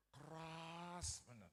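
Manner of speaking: KERAS stretched very slowly; drawn-out emphasis. Real, not acted. Preacher describing his wife's teak-hard temper, Keras drawn out. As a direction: draw out the word Keras.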